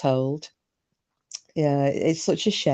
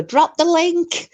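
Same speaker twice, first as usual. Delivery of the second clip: squeaky high, slow call; playful high-pitched imitation; mock Tiz request; 13 semitones higher, slower than usual. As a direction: pipe it up high and drawn out. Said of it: "She'd just go".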